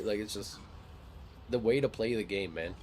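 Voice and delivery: usual speaking voice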